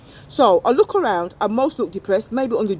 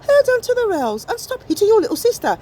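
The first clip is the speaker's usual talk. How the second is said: a hint of a shrill mum, pitched higher; scolding mum; 10 semitones higher than usual. Mother on the bus scolding her child, higher pitch. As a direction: tell the kid off.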